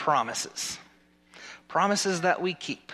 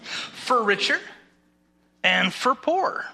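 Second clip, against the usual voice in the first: higher, deliberate ceremonial phrasing; wedding officiant; 8 semitones higher than usual. Acted. Officiant feeding vows to the groom, raised and measured. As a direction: lift each half of the vow, with a pause between. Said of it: "Repeat after me".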